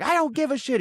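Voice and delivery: high-pitched exasperation; mock angry retort. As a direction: act out a fed-up outburst.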